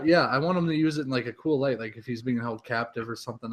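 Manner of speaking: usual speaking voice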